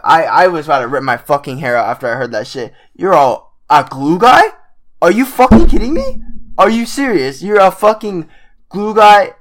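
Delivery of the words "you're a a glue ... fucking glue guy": loud, high-pitched incredulous rant; exasperated disbelief. Real, not acted. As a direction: demand an answer, voice jumping up in disbelief. Incredulous rant at player's quote.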